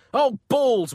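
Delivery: high, loud pained dismay; wincing. A narrator's reading. Pope appalled by the painted ceiling, wincing loudly. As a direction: wince it out in exasperation. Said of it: "winced the Vatican vicar".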